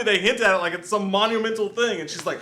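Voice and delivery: normal talking voice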